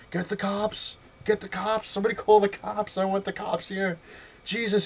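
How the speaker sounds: high, frantic yells for the cops; furious yelling. Retold or imitated. Store owner yelling at everyone to call the cops, furious. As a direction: bellow for the police, outraged.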